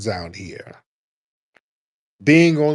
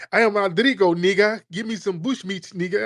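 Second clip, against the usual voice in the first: high, hammed-up African accent mockery; lightly mocking fake African accent, pitched up; 8 semitones higher than usual. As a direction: parody his slipping into an African accent. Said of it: "you went from a Dominican accent to an African accent".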